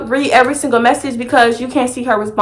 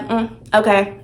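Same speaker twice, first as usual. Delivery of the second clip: low, quiet sulky reply; short and withholding; softer sulky nothing; cold brush-off; 4 semitones lower, 6 dB quieter than usual. Retold or imitated. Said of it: "wasn't really being super enthusiastic".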